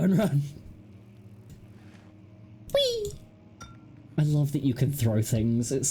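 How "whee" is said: very high, gleeful squeal; gleeful whee. Real, not acted. Throwing an object again.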